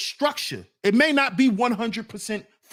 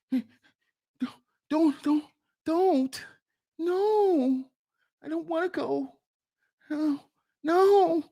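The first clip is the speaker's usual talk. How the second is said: mock pleading; 6 semitones higher, slower than usual. Acting man being taken by police.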